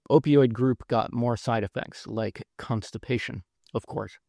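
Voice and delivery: ordinary talk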